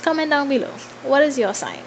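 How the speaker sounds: usual speaking voice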